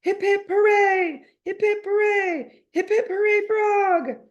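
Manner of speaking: high, loud, slow chanted hooray; group cheer. A narrator's reading. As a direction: cheer it like a whole class. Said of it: "the whole class cries".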